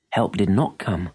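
usual speaking voice

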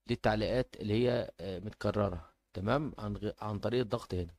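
ordinary talk

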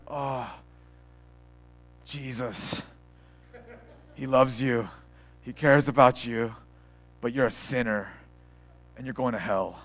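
low, soft, halting delivery with long pauses; mock-gloomy, reluctant evangelist; dreading. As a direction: deliver good news like a miserable chore, acted out. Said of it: "you come to it with this dread".

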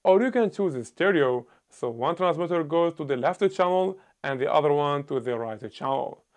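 ordinary talk, nothing marked

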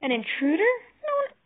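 high, loud, shocked yelp; alarmed squeal. A narrator's reading. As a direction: rise from startled question to a stretched yelp. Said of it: "she yelped".

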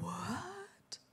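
breathy whispered what; shocked whisper. Retold or imitated. Hearing there's no insurance, a shocked whisper. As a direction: whisper it in disbelief.

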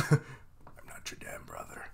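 low hissed whisper; whispered retort. Retold or imitated. Other half snapping back at his twin, whispered. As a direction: mutter it under your breath, annoyed. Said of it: "the other half is like".

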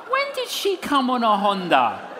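loud, high incredulous questioning; mock bafflement. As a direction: query it loudly, comically puzzled. Mock puzzled reply to tongues.

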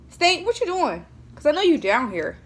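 high call, a bit farther off; calling out again. Real, not acted.

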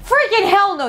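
hammed-up angry shout; mock rage. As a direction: bellow it like a hero losing it. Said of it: "That is rage".

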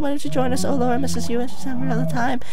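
creepy wife luring her husband; sinister mother. Acted. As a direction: invite him in with creepy sweetness.

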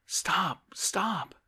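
high, loud calling out; urgent high-pitched shout; alarmed cry. A narrator's reading. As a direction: cry out to halt someone leaving. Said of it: "cried Oblomov".

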